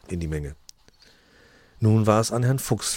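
plain reading voice

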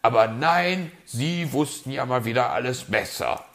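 exasperated fox, voice lifted; grim reproach. A narrator's reading. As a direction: lay into the know-it-all. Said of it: "sagte der Fuchs grimmig".

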